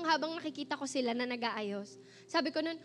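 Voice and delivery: ordinary talk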